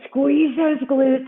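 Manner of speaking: loud, slow and high; loud coaching call. Real, not acted. Coach urging at end of set.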